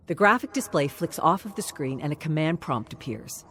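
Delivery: plain reading voice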